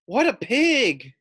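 high, slow exclaiming; delighted call. Acted. Girl in the crowd calls out, delighted. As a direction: laugh along from the crowd. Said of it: "called Emma".

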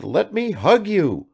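put-on high, eager old man's voice; delighted father; joyful. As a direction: arms open, beaming. Father embracing son.

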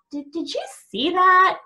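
rising, eager "did you see that"; excited retelling. A narrator's reading. Eric telling his friend about the brother freaking out, excited.

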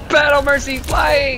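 slow, high battle cry; hype shout. Real, not acted. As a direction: announce it like a war cry. Hyping teammate's ultimate.

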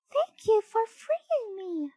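very high, slow, sing-out bird voice; squeaky little chick giving thanks; twittering chick. A narrator's reading. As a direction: pipe it like a baby bird. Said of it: "she twittered".

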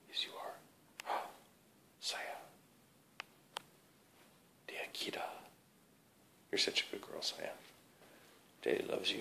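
soft whispered dog praise; whispering to a dog. Real, not acted.

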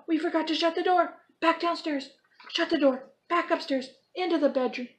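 high, loud hurried panic; frantic. Acted. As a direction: rush it in a panic. Frantic run home from bear.

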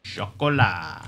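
drawn-out, falling French flourish; hammed-up pretentious French; mock French accent. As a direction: linger snootily on the French vowels. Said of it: "Like, really pretentious?".

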